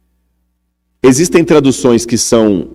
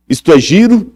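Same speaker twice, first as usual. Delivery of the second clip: higher, European Portuguese lilt on "giro"; Portuguese from Portugal; 6 semitones higher than usual. Shown as a demonstration. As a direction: mimic a Lisbon speaker saying "giro". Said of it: "Por exemplo". (sotaque português de Portugal)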